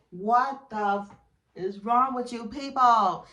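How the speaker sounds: loud, drawn-out disbelief; punchy mock outrage at the couple; exasperated outburst. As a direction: demand it slowly and loudly, appalled. Outraged reaction to the show.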